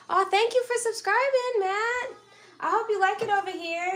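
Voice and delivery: thrilled by the new subscriber; excited thanks. Real, not acted. Streamer thanking Matt for subscribing, high and thrilled.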